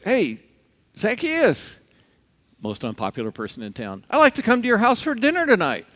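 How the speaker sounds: high, loud friendly call up into a tree. Retold or imitated. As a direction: invite him down cheerfully. Jesus calling up to Zacchaeus.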